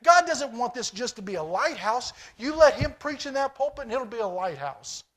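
loud, high-pitched pulpit exhortation; preaching at full force. Acted. Pastor urging his church to take up ministry, preaching at full force. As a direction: preach it hard to the pews.

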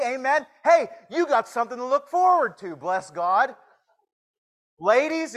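high, loud, fired-up preaching; full-force preaching. Real, not acted. Preacher rousing the men and ladies, loud and high. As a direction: drive it home to the congregation, loud and high.

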